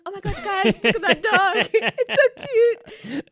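very high, loud, squealing delight, slowing at the end; stoned girl squealing at a rock she thinks is a dog; gushing over a dog. Retold or imitated. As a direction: coo at the cute dog through laughter. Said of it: "I'm like".

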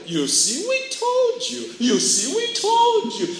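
singsong leap up to a high squeak; mock told-you-so. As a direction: crow softly, swooping high at the end. Mocking voice crows after winning over.

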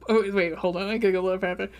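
ordinary talk

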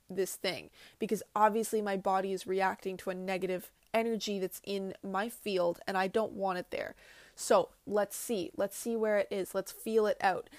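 ordinary talk

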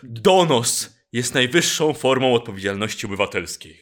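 loud, weighty declaration; solemn proclamation. Shown as a demonstration. Host delivering the motto about denunciation, solemn and loud. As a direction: proclaim it like an official slogan. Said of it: "no to trzeba tak".

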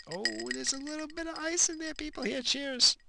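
high mock cheer; silly high voice. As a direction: toast the viewers in a goofy falsetto. Toasting viewers over ice.

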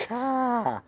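car said cah, r dropped, high; Boston car. Shown as a demonstration. Host showing Wayne the Boston car, r dropped. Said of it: "It's more Boston".

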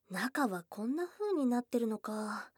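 ordinary delivery

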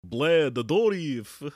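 slow, high, loud hissing vampire. Pretend. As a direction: hiss the name like a feral vampire. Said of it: "I shouldn't have done the vampire voice".

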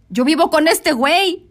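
retold outburst of dismay; exclaimed realization. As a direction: blurt it out loud and slow, reliving the shock. Retells her alarmed realization.

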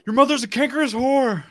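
high, loud hammed-up screech; movie insult quote. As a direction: spit the insult like a raving addict. Quoting a movie insult line.

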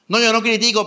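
high, loud mock denial; mocking self-excuse. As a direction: mimic someone excusing themselves. Preacher mocks a self-excuse.

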